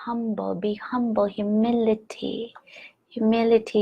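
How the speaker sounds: soft whispered inner voice; intuition whispering. Pretend. Intuition gently whispering advice to [name], softly. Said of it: "my intuition sometimes says".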